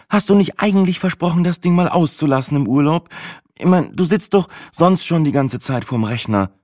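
high-pitched kindergarten-teacher scolding; Ursula von der Leyen kindergarten tone. A narrator's reading. Sina scolding the narrator for being on his phone, kindergarten tone. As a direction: reproach him in a high, singsong nursery tone. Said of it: "in einem Ursula von der Leyen Kindergartentonfall".

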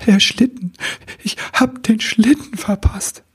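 high, whimpering little wichtel voice; a touch of sobbing, pitched high; tearful. Acted. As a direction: lament the missed sleigh, close to tears. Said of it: "Er schluchzte".

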